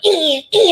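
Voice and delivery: two little zapped noises; mock involuntary squeals; imitated jolting grunts. Imitating jolts while walking.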